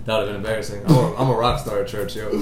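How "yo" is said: ordinary talk, nothing marked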